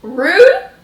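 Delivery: loud, high mock indignation; playfully offended. She fakes offence at the laughing cab, loud.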